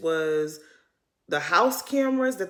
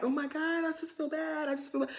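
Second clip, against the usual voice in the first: mock crying; 7 semitones higher, 8 dB quieter than usual. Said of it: "I would have been crying like".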